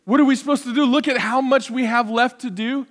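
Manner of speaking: usual speaking voice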